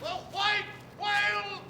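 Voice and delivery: high, strained distant shouting; calling across the sea. Acted. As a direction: hail the other captain. Shouting between ships at sea.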